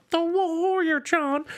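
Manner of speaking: mock girlfriend cheer. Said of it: "And then".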